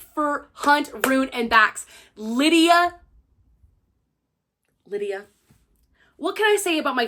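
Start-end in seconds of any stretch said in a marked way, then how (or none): 2.2-3.0 excited announcement. Real, not acted. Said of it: "announces favorite character". 4.9-5.3 soft repeat. Real, not acted. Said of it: "repeats name quietly".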